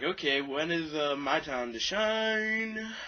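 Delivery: high, slow, drawn-out whine; exasperated plea. Retold or imitated. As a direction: stretch it out, fed up. Pleading to the universe.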